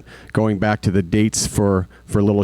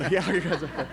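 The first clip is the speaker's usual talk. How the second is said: laughing; 9 semitones higher, 9 dB quieter than usual. Real, not acted. Laughing at colleagues' teasing.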